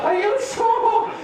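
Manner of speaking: high, drawn-out call; hammed-up little brother's shouted question; insistent checking. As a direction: stretch it out, loud and very high. Asks older brother again, calling out.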